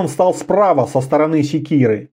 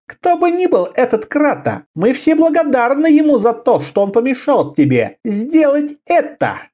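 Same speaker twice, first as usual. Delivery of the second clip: much higher woman's mocking voice; female voice; 8 semitones higher than usual. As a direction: keep the high woman's voice. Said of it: "сказала она".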